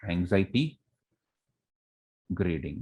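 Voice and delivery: "Anxiety" rising, a held pause, then "grading" falling; dictating the term. Shown as a demonstration. Teacher answering the class's question, dictating the term. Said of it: "What it is called?".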